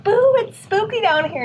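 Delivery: very high hammed-up ghost voice; mock spooky ghost. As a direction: play a cheesy ghost saying boo. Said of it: "We're like".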